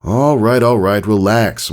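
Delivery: calm her down, lightly voiced; amused father. A narrator's reading. Father answering his eager daughter, mildly amused. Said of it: "father answers her eagerness".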